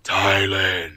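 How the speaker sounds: deep, slow, booming mock divine voice; mock voice of God. Minister mocking the thunderous-vision stories with a booming godlike voice. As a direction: ham up a thunderous heavenly voice calling his name. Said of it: "I don't say things like".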